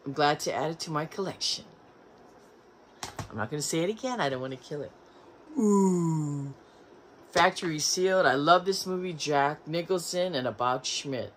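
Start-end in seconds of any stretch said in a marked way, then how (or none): none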